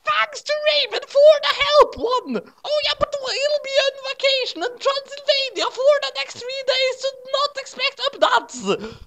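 high-pitched put-on author's note voice.

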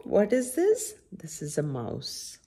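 ordinary delivery

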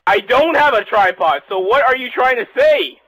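loud, high, slowed down shout; exasperated shout. Real, not acted. Shouting a friend's quote back at him, loud and exasperated. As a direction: throw his words back at him, shouting. Friend arguing about the tripod.

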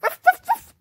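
very high, loud little dog barks; high puppy yaps. Pretend. Puppy cheering on D J, very high excited yaps. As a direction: yap like an excited toy puppy.

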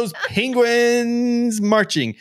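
Jill. Retold or imitated. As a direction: imitate a cohost's signature outro. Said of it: "I wanted to be Jill so bad".

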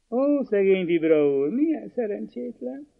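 teasing, rising high on the pity; mock pity. A narrator's reading. Veronka teasing the lawyer after his rejection, mock sympathy. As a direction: playfully pity him, tongue in cheek. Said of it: "És hamiskásan mosolygott hozzá".

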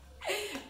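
short high laugh. Real, not acted. Vlogger laughs after saying she's hungry, high bright laugh. Laughs at her own hunger.

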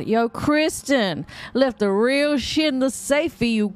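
lively put-on reading voice; casual note-writer's voice. Player reading a note left for a colleague, put-on voice. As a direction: read it out as a chummy, impatient co-worker. Reads a game note in character.

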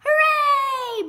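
high, loud cheer. A narrator's reading. Runt celebrates no turkey sandwich, loud high cheer. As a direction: belt out a long hooray.

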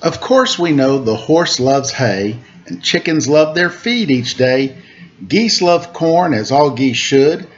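lilting storybook rhymes, slow refrain; reading to children. A narrator's reading. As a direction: read the rhyme aloud to little listeners. Reads picture book to children.

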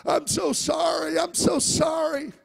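high, loud, slow imitated sobbing plea; retelling a caller's tearful, pleading apology; crying apology. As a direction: mimic a tearful woman begging forgiveness. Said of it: "And she was crying".

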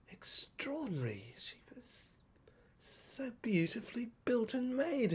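soft awed whisper; hushed marvelling whisper. A narrator's reading. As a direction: breathe it out in wonder.

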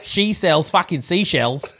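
Australian accent. Shown as a demonstration. Saying the set tongue twister for a friend, Australian accent. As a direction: ham up an Australian accent, laughing by the end. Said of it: "Australia and say".

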